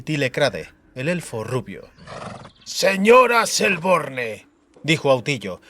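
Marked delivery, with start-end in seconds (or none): 2.6-4.5 slow drawn-out venomous words; venomous drawl. A narrator's reading. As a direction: drag out the name with venom. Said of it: "arrastrando una voz cargada de veneno". (arrastrando la voz, con veneno)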